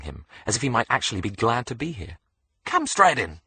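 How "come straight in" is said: high, loud cheery Aussie welcome; Australian nurse. A narrator's reading. Nurse waving Alex inside, in a high cheery Australian voice. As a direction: call him in like a hostess.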